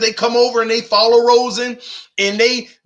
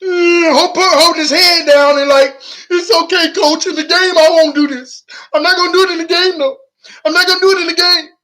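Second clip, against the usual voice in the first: whiny player voice; 8 semitones higher, 5 dB louder than usual. Retold or imitated. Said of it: "I don't know no quarterback that be in practice saying".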